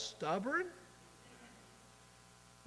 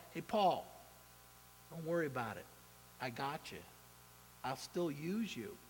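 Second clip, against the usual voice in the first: softer, gentle reassurance to a worried man; reassuring God; 6 semitones lower, 8 dB quieter than usual. Retold or imitated. God comforting Paul in jail, soft and reassuring. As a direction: let God calm Paul down, hushed and kind. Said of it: "here's God saying to Paul".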